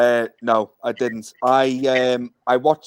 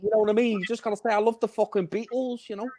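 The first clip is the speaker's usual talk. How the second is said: high-pitched mock Liverpool accent; demonstrating a Liverpudlian accent; Scouse accent; 8 semitones higher than usual. Shown as a demonstration. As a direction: put on a lilting Scouse voice. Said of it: "Can you do a Scouse accent, J T?".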